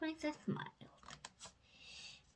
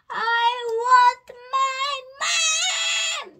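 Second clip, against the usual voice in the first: high, very loud, long-held wail; yell; 12 semitones higher, 15 dB louder, much slower than usual. A narrator's reading. Little princess after spilling her paint water, drawn-out yell. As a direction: yell it, stretching the last word right out. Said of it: "yelled the little princess".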